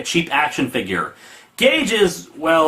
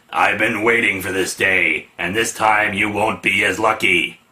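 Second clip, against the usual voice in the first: mock heroic shout; 8 semitones lower than usual. Die Hard punches Strong Arm, low mock-heroic declaration. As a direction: declare it deep, without really shouting. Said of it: "while shouting".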